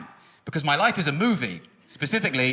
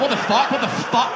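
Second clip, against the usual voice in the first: mock shocked father; 7 semitones higher than usual. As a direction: blurt it out like a stunned father. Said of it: "He'll be like".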